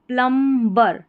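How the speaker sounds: प्लम्बर with the B wrongly pronounced; B sounded. Shown as a demonstration. Teacher showing how not to read it, प्लम्बर with B sounded. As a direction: hit the "bar" in प्लम्बर. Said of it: "ऐसे नहीं पढ़ना है".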